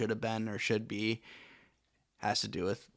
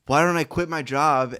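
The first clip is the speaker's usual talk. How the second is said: louder, rising rant at himself; worked-up self-talk; 4 semitones higher, 7 dB louder than usual. Retold or imitated. A friend ranting at himself about quitting his job to live in a van. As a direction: talk yourself into a frenzy. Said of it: "And I'm like".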